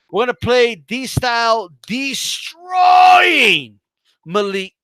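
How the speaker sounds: big announcer-style build to a drawn-out DESTROYING; hype announcement. Real, not acted. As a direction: stretch DESTROYING into a long shout. Hyping the clip about to play.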